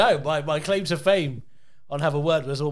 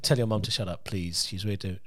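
low, soft, polite dad voice; a quiet request, lightly voiced as dad; imitating calm dad; 11 semitones lower, 10 dB quieter than usual. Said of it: "So he'll be talking to me".

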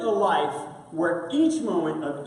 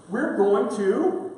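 ordinary talk, nothing marked

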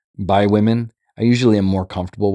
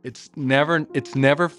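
normal talking voice

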